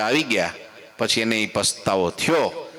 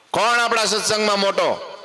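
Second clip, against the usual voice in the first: Maharaj's question; 10 semitones higher, 3 dB louder than usual. Retold or imitated.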